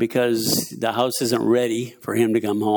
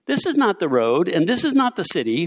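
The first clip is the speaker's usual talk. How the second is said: higher, measured reading of Elijah; scripture quote; 6 semitones higher than usual. A narrator's reading. Preacher reads Elijah misleading the enemy, measured and raised. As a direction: declare it as the prophet's written words.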